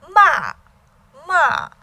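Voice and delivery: loud MA falling sharp and strong; fourth tone. Shown as a demonstration. Teacher explaining fourth tone, sharp falling MA. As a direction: throw MA from high down to the bottom. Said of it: "falls sharp and strong".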